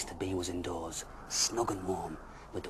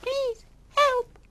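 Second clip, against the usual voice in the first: tiny squeaky plea, much higher and louder; very small voice; 14 dB louder than usual. Acted. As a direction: squeak up from around the ankles. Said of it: "said a very small voice".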